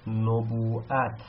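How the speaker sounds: نبوءت drawn out slowly, syllable by syllable; careful slow teacher reading of نبوءت; slow model reading. Shown as a demonstration. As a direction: spell out نبوءت slowly for students. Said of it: "مثل".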